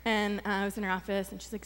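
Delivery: normal talking voice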